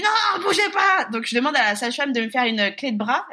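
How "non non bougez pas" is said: louder, higher barked order; mock sharp order. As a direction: order her still sharply. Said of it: "Il dit". (ordre sec et fort imité)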